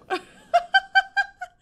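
very high, loud giggle; helpless high giggling; excited laugh. Real, not acted. Laughs after second scare.

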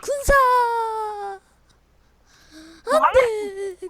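high, loud wail of no; anguished yell. Real, not acted. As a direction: cry out in despair. Caught in game, crying out.

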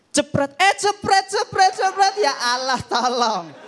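high, loud startled clicks; latah camera clicks. Pretend. Latah woman startled by each camera click, high yelps. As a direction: jump at every shot.

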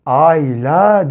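"Ayla" slow and low, "ay" then a held "la"; blending syllables. Shown as a demonstration. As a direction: join "ay" and "la" slowly into "Ayla". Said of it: "diye yazarız". ("Ayla" sözcüğünü yavaşça heceleyerek okuma)

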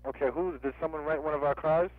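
ordinary talk

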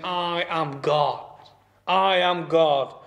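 slow, weighty I am God; solemn declaration. Acted. Pastor voicing Jesus' claim, slow solemn declaration. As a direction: press each word down, slowly. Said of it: "He's saying".

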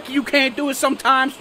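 normal talking voice

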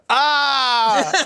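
high, loud drawn-out "ah"; hammed-up cry of horror; acted-out yell. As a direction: yell as if biting something awful. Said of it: "It was like".

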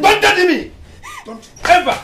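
high, loud angry shout; father rejecting his daughter, shouting angrily; furious rejection. Acted. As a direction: cut her off in fury.

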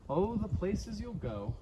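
usual speaking voice

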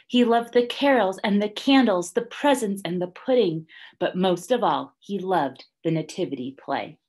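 ordinary talk, nothing marked

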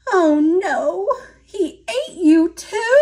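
put-on high-pitched storybook child in dismay; dismayed little girl. A narrator's reading. Little Red to swallowed Nai Nai, high and dismayed.

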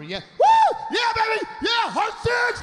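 high whooping shout of a winner; gambling cheer. Retold or imitated. Men winning at the craps table, whooping loudly. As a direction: scream like you just hit at craps. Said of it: "high-fiving and screaming".

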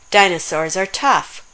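ordinary delivery, nothing marked

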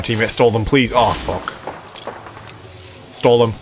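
usual speaking voice

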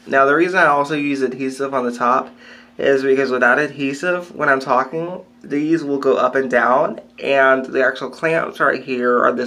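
lip pulled back by finger; talking while pointing at teeth; finger in mouth. Real, not acted. Seen 1: finger on teeth and lip, lifting it while showing dentures.

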